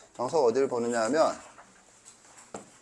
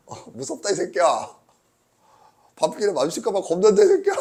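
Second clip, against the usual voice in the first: mock scared laughing; 8 semitones higher, 7 dB louder than usual. Joking fear at student.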